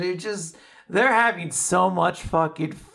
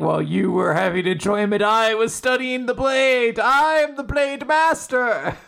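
rising grandiose boast, high and loud; pompous boast; 6 semitones higher, 4 dB louder than usual. Pretend. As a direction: puff up like a self-proclaimed master. Mocking blade master boast.

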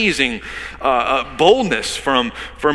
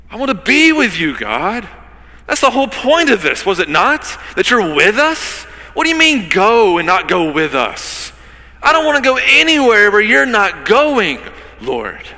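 high, loud impassioned cry to God; preaching at full force; 10 semitones higher, 8 dB louder than usual. Acted. Preacher cries out to God to stay with his people, loud and high.

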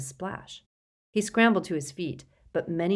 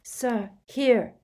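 urgent yell, higher-pitched; raised, insistent cry; Harry; 6 semitones higher, 4 dB louder than usual. A narrator's reading. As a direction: call out frantically. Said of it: "Harry yelled".

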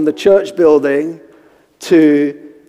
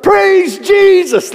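very high, slow, full-voiced praise; praising like he means it; 4 dB louder, much slower than usual. Young man finally obeys the elder, fervent praise. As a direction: belt it out, each word stretched. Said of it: "say it like you mean it".

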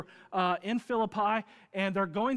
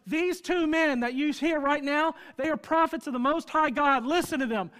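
louder, higher mock yell of a girl; slave girl yelling; 8 semitones higher, 7 dB louder than usual. Slave girl shouting after Paul and Silas, mock yell. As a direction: call it out after them like the girl. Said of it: "she starts yelling".

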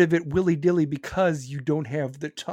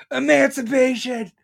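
higher, louder triumphant cry of the word; gleeful shout; 7 semitones higher, 4 dB louder than usual. Acted. Ranting podcaster crowing his punchline, loud and gleeful. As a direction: yell it like a victory whoop.

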